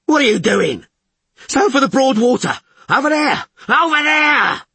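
very high, loud protest; frantic bellowing. A narrator's reading. Fish urging skipper to broad water, frantic high bellow. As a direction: bellow urgently, pointing the way. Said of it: "Fish bellowed".

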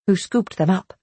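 ordinary delivery, nothing marked

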